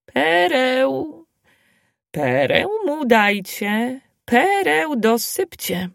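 high, slow singsong call; a lightly voiced granny calling out; old granny calling after. A narrator's reading. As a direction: call after him like an old wise woman. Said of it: "wołając za nim".

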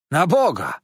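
loud, high exclamation; exclaiming. A narrator's reading. Waiter astonished at the cutlets, loud exclamation. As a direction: burst out in amazement. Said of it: "wykrzyknął".

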